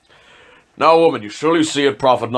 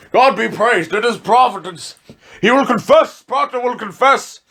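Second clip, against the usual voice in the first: much higher, loud triumphant cry; exultant; 11 semitones higher, 3 dB louder than usual. Acted. Official hearing the prisoner will confess, exultant high cry. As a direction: crow with delight that he gives in.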